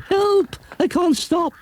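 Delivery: she can't stop her legs; panicked cry. Acted. Runaway lady panics.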